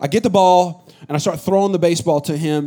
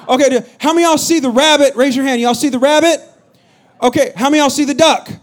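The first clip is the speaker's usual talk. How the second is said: higher, louder playful quizzing; rally the crowd to raise hands; excited host; 6 semitones higher, 4 dB louder than usual. Real, not acted. Pastor quizzing the crowd on a picture, lively.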